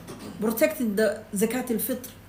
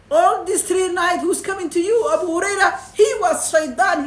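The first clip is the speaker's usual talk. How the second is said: emphatic reveal; 6 semitones higher, 5 dB louder than usual. Retold or imitated. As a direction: announce the big reveal with weight. Prophet asks, answer revealed.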